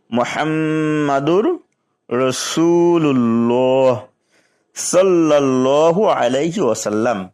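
measured recitation with long vowels; kalima recitation. Shown as a demonstration. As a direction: recite the kalima slowly for learners. Recites the whole first kalima. (কালিমা তিলাওয়াতের ভঙ্গিতে পড়া)

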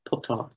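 puton with U as in "put"; puton, first vowel U, not A; changed form. Shown as a demonstration. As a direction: softly repeat puton as "PUUT-awn". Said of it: "paton has become puton".

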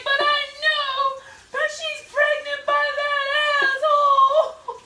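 high, loud, drawn-out whine; mock tearful wail. Daughter imitates mom complaining to grandma, dramatic wail. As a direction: keep wailing, stretching the last word. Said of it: "then she was like".

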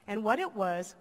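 ordinary delivery

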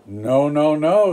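loud, high "no, no, no"; shouted refusal. Acted. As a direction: yell it out, refusing the pig. Wolves refusing the pig.

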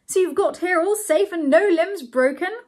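high, loud cheery host's greeting; hearty cry. A narrator's reading. Bernard greeting his newly arrived guests, crying out. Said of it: "he cried".

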